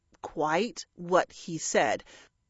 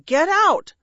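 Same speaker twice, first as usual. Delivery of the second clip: high, loud yell; shout; 9 semitones higher, 9 dB louder than usual. A narrator's reading. Parrot to invading crows, furious shout. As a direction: shout to drive them off. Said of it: "Bertie shouted at them".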